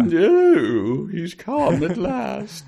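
high, slow hammed-up fop; mock fop voice. Cohost mocks fancy vampires welcoming Carlisle, high hammed-up fop voice. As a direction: swoon over the guest like a powdered aristocrat.

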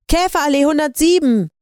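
high, hurried shout back; calling over shoulder. A narrator's reading. Lydia running out, calling the address back to boyfriend. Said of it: "rief Lydia über ihre Schulter".

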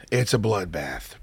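low, soft and slow repeat; slow, hushed, low-pitched confirmation; grim understatement. Real, not acted. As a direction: drop the voice to a quiet, grave verdict. Low repeat of dramatic line.

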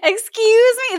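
high, indignant excuse me; clipped scandalized retort; mock offended.

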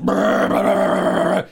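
loud, high sputtering "brr" of an engine; engine noise. Shown as a demonstration. Demonstrating engine sounds people could play while driving, loud imitated engine. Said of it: "super loud engine sounds".

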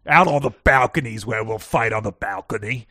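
very loud British challenge; British accent. Acted. Co-host calls the friend out to fight, shouted British accent. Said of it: "give me your British accent again".

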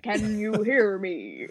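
slow, drawn-out character quote; grave, stretched-out dramatic question; Ragnar. Retold or imitated. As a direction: intone it like an audiobook narrator, very slowly. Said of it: "Ragnar's first words in Golden Sun".

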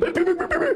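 high stuttering goblin jabber; mock electrocution noise; electrocuted goblin cry. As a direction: yelp in rapid bursts as if being shocked. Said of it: "Now they're electrocuting you".

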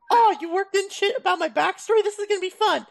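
high, bright fake enthusiasm; sarcastic gushing; mock excitement. Mocking an excited player.

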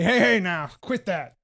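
lightly voiced gruff old sheriff; gruff old man. Old sheriff interrupts a brawl, lightly gruff old man. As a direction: break it up with a touch of old-timer gruffness. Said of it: "you hear a gruff old man say".

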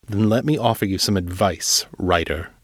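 plain reading voice

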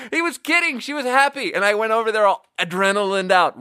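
very high, laugh-strained voice; squeaky with laughter. Real, not acted. Revealing the coworker's prank, squeaky with amusement. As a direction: squeal it out after a laughing fit. Retells punchline after laughing fit.

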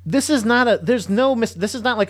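a high, loud, heated protest; heated rant. Real, not acted. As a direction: push the point, heated and rising. Worked-up rant.

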